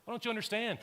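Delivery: acted upset exasperation; distressed protest. Voices weeping Mary, exasperated paraphrase.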